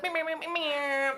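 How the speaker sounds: high playful meowing; cat meows. Shown as a demonstration. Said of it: "That's, that's a common sound in our house".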